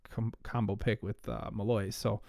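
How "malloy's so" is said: normal talking voice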